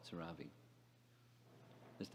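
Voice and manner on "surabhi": Surabhi with stressed middle, sur-RAH-bee; Chinese-style mispronunciation. Retold or imitated. Listeners in China recognize the name, said sur-RAH-bee. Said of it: "in China, they always say it".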